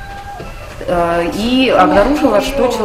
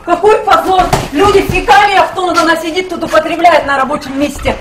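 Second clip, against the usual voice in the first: angry yelling; 8 semitones higher than usual. Real, not acted. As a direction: yell at them in outrage. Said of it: "А вы на меня орёте вообще?".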